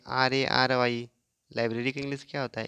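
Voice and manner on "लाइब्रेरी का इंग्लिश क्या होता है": normal talking voice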